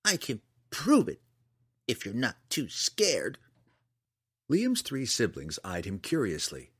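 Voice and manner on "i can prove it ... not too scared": put-on high-pitched, deliberate boy's challenge; adamant. A narrator's reading. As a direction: dare your siblings, slowing at the end. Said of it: "his tone told them that there was substance".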